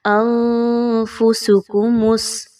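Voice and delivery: انفسکم with hidden nasal ن before ف; ikhfa demo. Shown as a demonstration. Teacher demonstrates ikhfa on انفسکم. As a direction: hide the ن in انفسکم, nasal only. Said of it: "اخفا کیجئے".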